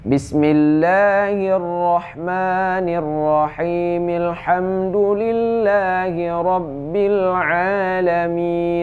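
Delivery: high, slow chanted recitation; connected recitation. Shown as a demonstration. Teacher reciting the verses joined without pause, chanted slowly. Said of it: "begitu cara bacanya kalau kita sambung".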